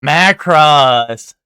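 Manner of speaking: loud, drawn-out call of the answer; loud triumphant call. Real, not acted. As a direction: belt out the title like a sure answer. Calls out the quiz answer.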